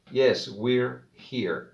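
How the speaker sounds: "Yes, we're here." very slow and clear; slow model answer. Shown as a demonstration. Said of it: "practique la pronunciación".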